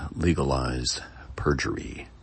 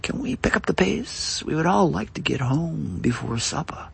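a hint of a fussy judge, pitched higher; impatient judge; 13 semitones higher than usual. Judge urges the lawyers to hurry, a hint of impatience. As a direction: hurry the court along, higher. Judge hurries the trial along.

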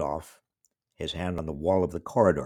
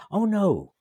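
surprised; 13 semitones higher, 6 dB louder than usual. Retold or imitated. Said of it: "they said".

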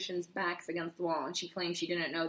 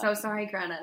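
ordinary delivery

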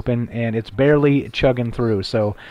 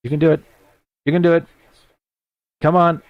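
higher-pitched pep talk to a balky machine; coaxing; 4 semitones higher, faster than usual. Real, not acted. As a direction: urge it on in short, separate pleas. Coaxing a struggling laptop along.

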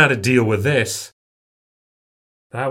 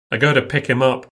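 plain reading voice

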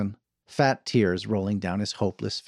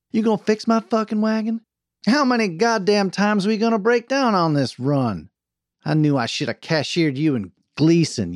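high, loud Western tirade; angry boss chewing out her driver; furious berating; 9 semitones higher, 4 dB louder than usual. A narrator's reading. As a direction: tear into him, starting high and easing down at the end. Wagon boss berating her teamster.

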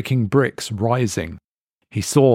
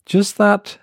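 higher, clipped-off reply; curt waitress; 11 semitones higher, 3 dB louder than usual. Acted. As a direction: cut yourself short. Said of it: "she cut the sentence short".

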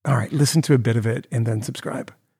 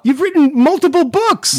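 high, incredulous heckle; exasperated outburst; 3 dB louder than usual. Real, not acted. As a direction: cry it out high in disbelief. Heckling the article's author again.

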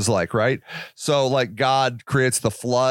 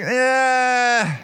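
doubtful shrug; 6 semitones higher, much slower than usual. Retold or imitated. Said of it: "I'm like".

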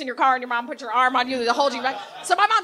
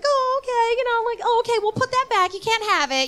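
high-pitched placating mom voice; imitating a mom humoring her kid; indulgent mom; 10 semitones higher than usual. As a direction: gently put the child off, pitched up high. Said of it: "and the mom's like".

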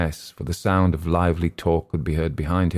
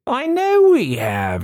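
mock shout, much higher and drawn out; shouting back; 3 dB louder, slower than usual. A narrator's reading. Tipsy wing commander shouting back at the pilot. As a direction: bellow it down the phone. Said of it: "he shouted back".

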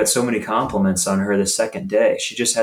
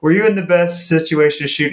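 upbeat question pitched higher; positive inflection; 5 semitones higher than usual. Retold or imitated. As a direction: ask with a smile. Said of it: "a positive inflection".

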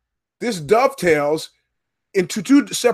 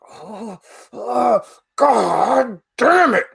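slow, cry-like wail, hammed-up; mock anguish; 5 semitones higher, much slower than usual. Fed-up customer wailing in hammed-up despair at service guy. Exasperated at repeated question.

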